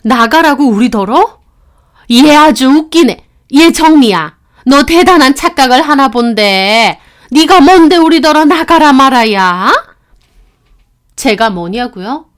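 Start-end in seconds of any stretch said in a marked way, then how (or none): 0.0-9.7 indignant mother-in-law. Acted. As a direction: snap back in outrage at being told to leave. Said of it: "어머님도 신우도 펄쩍 뛰더라고요". (화가 나서 따지는 시어머니)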